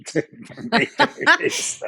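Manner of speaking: high laughing voice; words squeezed out through giggles. Real, not acted. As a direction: break up laughing mid-sentence.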